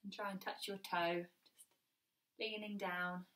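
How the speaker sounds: soothing fitness cool-down cue; calm cool-down guidance. Acted. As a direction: ease the class into rest. Instructor leads a relaxing cool down.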